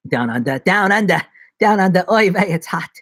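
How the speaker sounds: playful mock Australian accent; hammed-up Australian, a bit loud; Aussie accent. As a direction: put on a punchy Aussie voice. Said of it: "There's my Aussie accent".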